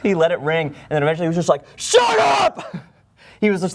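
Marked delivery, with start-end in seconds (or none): 1.7-2.8 high-pitched imitated shout; yelling. Imitating the mover yelling at his girlfriend on the phone, sudden shout. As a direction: yell it suddenly, far above your talking pitch. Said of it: "yelling at his phone".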